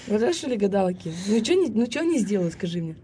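normal talking voice

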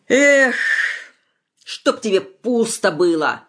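loud then slow cursing; angry curse. A narrator's reading. Father curses the bride, loud then slow. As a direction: curse her as she leaves.

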